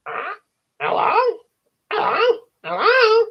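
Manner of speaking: very high parrot squawk. Acted. Parrot calls out to the waiting children, high squawky voice. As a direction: squawk it like a talking bird. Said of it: "the parrot said".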